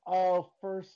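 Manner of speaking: far from mic. Real, not acted. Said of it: "it sounds like you're far away from your microphone".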